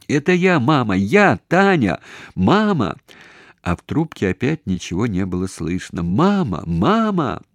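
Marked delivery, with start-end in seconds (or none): 0.1-3.0 high, loud urgent calls; crying out. A narrator's reading. Daughter tells faint-voiced mother who calls, crying out. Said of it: "закричала". 6.0-7.4 high, urgent call; crying out. A narrator's reading. Daughter hears nothing from mother on phone, crying out. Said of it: "закричала".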